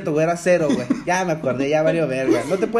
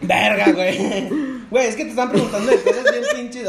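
laughing, louder and higher; 5 semitones higher, 4 dB louder than usual. Real, not acted. Friend laughing at the too-hard quiz questions, loud.

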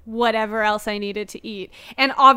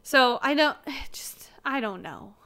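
defeated sigh; 7 dB quieter than usual. Real, not acted. Sighs, gives up on critics.

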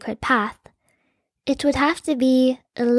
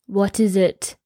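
low, curt king voice; a lightly voiced impatient father; 4 semitones lower than usual. A narrator's reading. King to his daughter at the door, curt impatience. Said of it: "looked at her with impatience".

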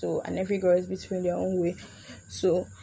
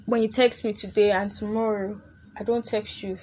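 normal talking voice

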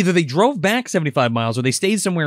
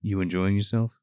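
lower, softer, calm threat; quiet menace; 8 semitones lower, 5 dB quieter than usual. Retold or imitated. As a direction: ask it low and cold. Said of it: "he's just like".

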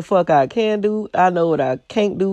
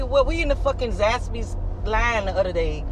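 ordinary delivery

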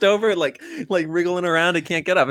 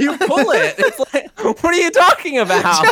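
laughing in disbelief; 10 semitones higher, 4 dB louder than usual. Real, not acted. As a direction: laugh through the protest. Laughing argument.

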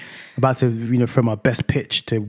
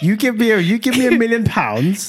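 high, slow playful demand; playful high-pitched counteroffer; excited haggling; 9 semitones higher, 3 dB louder than usual. Real, not acted. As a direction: drag it out like a bargain. Excited haggling over the bet.

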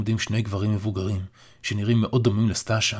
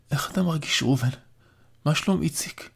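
put-on weak, higher voice of a dying old man; frail old father; 6 semitones higher than usual. A narrator's reading. Dying father asks his son about family, weak and higher. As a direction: speak faintly, like an old man just waking. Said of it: "סטאשה שואל בקול חלוש".

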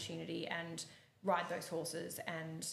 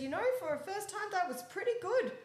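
much higher mock approval; grudging coach praise; 13 semitones higher, 4 dB louder than usual. Coach grudgingly praises the eventer, high mock approval. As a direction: play the coach, surprised it worked.

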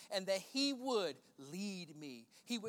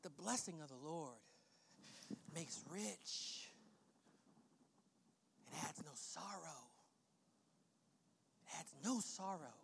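very soft, low recitation with long pauses; low, reverent hush; hushed scripture; 5 semitones lower, 13 dB quieter than usual. Real, not acted. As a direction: savour each phrase almost under the breath. Hushed, savoured scripture quote.

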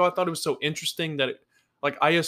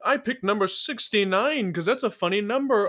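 pompous rich guy; 5 semitones higher, 5 dB louder than usual. Retold or imitated. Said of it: "He's like".

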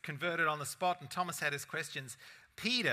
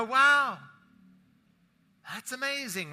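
high, loud wow of amazement; a sudden amazed cry, pitched high and loud; 8 semitones higher, 10 dB louder, slower than usual. Acted. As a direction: burst out in delighted surprise. You go, amazed at help.

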